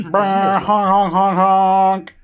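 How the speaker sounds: imitated horn honks; honking noises. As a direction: honk like a clown horn. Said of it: "we can't afford sound effects".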